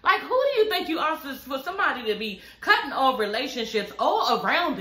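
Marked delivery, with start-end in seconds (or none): 0.0-1.3 high-pitched, laughing retelling of a pissed-off friend; half-laughing mock outrage, pitched way up; mock angry Martell. Retelling how Martell snapped at her former friend, high-pitched and laughing. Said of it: "Martell was pissed".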